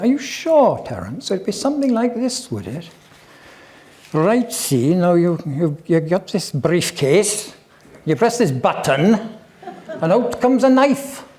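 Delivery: high, lilting Welsh voice with laughter; sing-song Welsh accent, laughing through. Retold or imitated. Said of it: "you have to excuse the Welsh accent".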